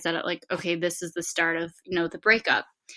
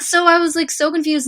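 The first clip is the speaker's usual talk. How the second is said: higher and louder, reliving the confusion; worked-up retelling; 10 semitones higher, 9 dB louder than usual. Real, not acted. Telling friend about the Thanksgiving snub, worked up and loud. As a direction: let the upset rise in your voice. Retelling upsetting moment, heightened.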